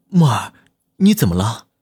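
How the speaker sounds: a touch of worried urgency; concerned, slightly urgent check-in; anxious question. A narrator's reading. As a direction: call out with mild alarm. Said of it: "龚若轩焦急地问道".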